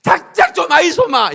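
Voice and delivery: imitating a Gyeongsang wife telling him to cut it out; Gyeongsang-dialect mock scold. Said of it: "경상도 말로 뭐라".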